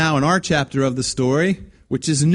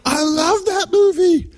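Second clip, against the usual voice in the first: high, slow gushing; mock gushing fan; 3 dB louder, slower than usual. Preacher mocking a fan gushing about Home Alone. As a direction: gush like a giddy fan. Mocking churchgoers who love the film.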